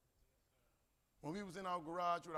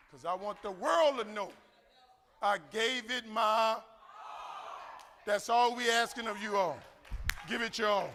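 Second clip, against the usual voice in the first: loud, slow, preacher-like build to the finish; rally the crowd with the last line; rousing climax; 5 semitones higher, 10 dB louder than usual. Real, not acted. Speech climax to audience.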